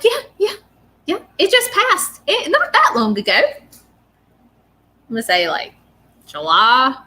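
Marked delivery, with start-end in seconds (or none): 0.0-3.3 put-on older woman's voice. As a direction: imitate an older lady, voice pitched way up. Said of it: "and she's like".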